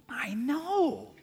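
high, hushed mock amazement; awed whisper. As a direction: whisper it as if thrilled by great news. Acts awe at free puppy offer.

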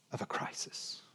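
breathy hushed whisper for weight; dramatic sermon whisper. Real, not acted. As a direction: drop to a whisper on the final words. Sermon line drops to whisper.

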